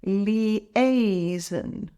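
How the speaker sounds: "Liaison" drawn out syllable by syllable; broken into syllables. Shown as a demonstration. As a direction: separate each syllable of "Liaison" for a learner. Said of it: "Let's break it down".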